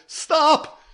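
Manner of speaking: piercing high loud shriek; terrified shrieked "Stop!". A narrator's reading. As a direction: scream it to cut him off. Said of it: "she shrieked".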